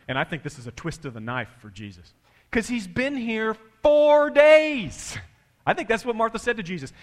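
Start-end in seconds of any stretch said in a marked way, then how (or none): 2.5-5.2 high, loud, drawn-out complaint; exasperated outburst. Acted. Acting Martha complaining to Jesus.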